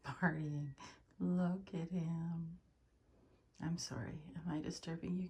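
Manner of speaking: normal talking voice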